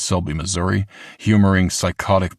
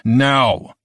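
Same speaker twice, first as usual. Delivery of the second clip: sharp command; 6 semitones higher, 5 dB louder than usual. A narrator's reading. Clerk shouts back.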